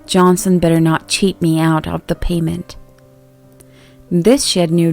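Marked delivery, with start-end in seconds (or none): none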